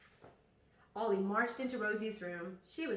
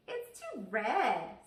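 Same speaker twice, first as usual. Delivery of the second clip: stubborn little sister; 4 dB louder, much slower than usual. Acted. Little sister refusing food.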